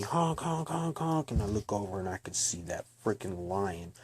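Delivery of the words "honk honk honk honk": high, punchy honk noises; imitated car horn. Storyteller imitates horn honking at him, high honks. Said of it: "I hear this horn honking".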